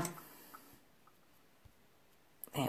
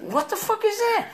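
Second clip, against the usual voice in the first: shocked question; 6 dB quieter than usual. Retold or imitated. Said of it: "And I was like".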